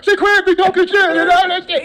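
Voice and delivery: high, loud, drawn-out mimicry; mock the haters' complaining; exaggerated high whine of a gossiping hater.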